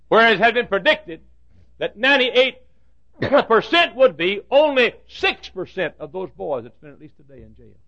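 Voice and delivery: loud, high preacher build; rising sermon crescendo; preaching at full force. Acted. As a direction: drive the numbers home from the pulpit.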